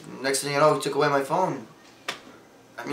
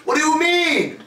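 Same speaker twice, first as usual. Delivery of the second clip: high-pitched, incredulous protest; exasperated outburst; 10 semitones higher, slower than usual. Acted. Patient refused his phone by therapist, exasperated. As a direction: burst out in disbelief.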